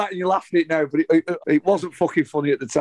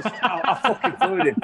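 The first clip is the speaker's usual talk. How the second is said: laughing; 3 semitones higher, slower than usual. Real, not acted. Laughs at getting wound up.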